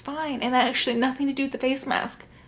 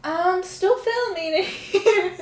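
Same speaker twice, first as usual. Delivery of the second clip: high, drawn-out call breaking into laughter; 6 semitones higher, 4 dB louder, much slower than usual. Real, not acted. Vlogger to someone creeping behind, high laughing call. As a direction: call it out slowly, half laughing. Calls to person in background.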